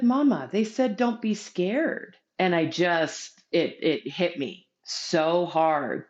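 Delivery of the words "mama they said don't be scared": put-on higher, slow child's line; retold young son. Young son passing on the angels' message to mama, gently higher. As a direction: lightly imitate a small boy reassuring his mother. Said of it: "And he said".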